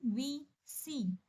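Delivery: वी and सी drawn out very slowly; vowel sound shown. Shown as a demonstration. Teacher slowly showing students the vowel in वी and सी. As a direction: stretch the vowels in वी and सी. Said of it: "सी थोड़ा सा जोर लग रहा है".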